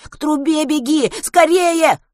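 panicked yelling; frantic urging. A narrator's reading. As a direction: scream at him to run.

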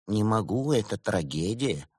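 ordinary delivery, nothing marked

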